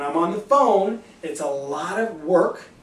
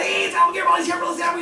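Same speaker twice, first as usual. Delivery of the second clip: much higher and louder sing-song hello; big energetic greeting; 11 semitones higher, 4 dB louder, much faster than usual. Shown as a demonstration. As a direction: announce yourself with huge energy. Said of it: "when I get Albert Garibaldi".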